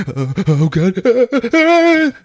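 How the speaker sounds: high, loud, gasping mock fright; hammed-up panicked gasps; terrified. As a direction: back away in exaggerated terror. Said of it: "He's, like, so terrified".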